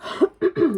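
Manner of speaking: rough throat clear. Real, not acted. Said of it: "frog in my throat".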